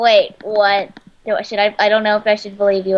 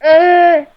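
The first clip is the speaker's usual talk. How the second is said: hiccup; 6 semitones higher, 6 dB louder than usual. Real, not acted. Speaker has hiccups.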